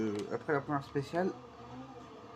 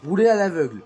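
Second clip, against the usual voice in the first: loud, higher-pitched heated defence; animated rant; 7 semitones higher, 4 dB louder than usual. Real, not acted. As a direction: argue your case, fired up. Defensive rant about driving.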